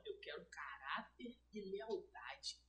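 slow unvoiced whisper, imitating him; whispered mentor. Mentor whispering his one demand to the seller, slow whisper. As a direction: breathe each word slowly, like a vow. Retelling mentor's hushed advice.

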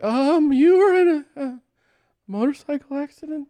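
very high, halting, tiny voice; panicked squeak. Retold or imitated. Telling injured man about accident, panicked squeak. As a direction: squeak out an awkward explanation, hammed up.

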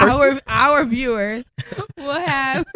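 laughing through the words; slow, loud, giggling. Real, not acted. Repeats phrase while laughing.

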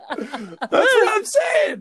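high, laughing squeal; real high laughter. Real, not acted. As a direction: laugh right through the words. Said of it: "laughing through it".

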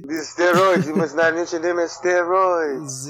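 put-on slow, lightly French-accented villain; playful fake French doctor; mock French. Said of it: "Let's see if I can do French".

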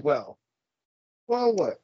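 normal talking voice

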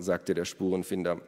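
usual speaking voice

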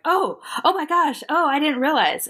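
high, startled mock realization; flustered apology. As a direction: act out a flustered woman caught off guard.

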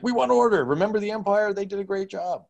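high, pleading galaxy citizens; pleading crowd voice. Retold or imitated. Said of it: "all the galaxy's gonna be like".

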